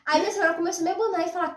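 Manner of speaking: ordinary talk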